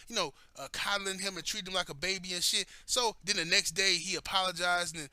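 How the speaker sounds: ordinary talk, nothing marked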